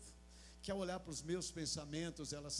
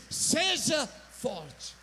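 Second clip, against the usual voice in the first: commanding shout; 10 semitones higher, 15 dB louder, much slower than usual. Pretend. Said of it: "que Deus falou e disse".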